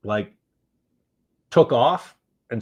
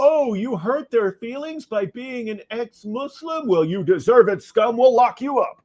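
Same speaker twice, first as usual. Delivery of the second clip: mock police; 12 semitones higher, 6 dB louder than usual. Mocking the police's attitude.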